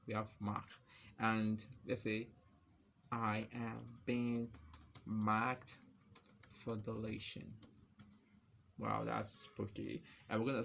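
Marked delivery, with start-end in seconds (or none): 3.1-7.5 slow phrases split by pauses; typing-pace dictation. Real, not acted. Typing marked example text, slow halting dictation. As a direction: dictate it slowly as you type.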